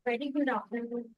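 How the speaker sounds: very soft, too far from the mic; speaking faintly, voice barely carrying; barely audible. Real, not acted. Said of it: "Be a bit, uh, slightly loud".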